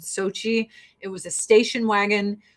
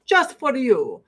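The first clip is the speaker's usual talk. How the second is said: louder, higher hammed-up Russian accent; 6 semitones higher, 6 dB louder than usual. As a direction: boom it out like a proud Russian mayor. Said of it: "the mayor of Sochi was like".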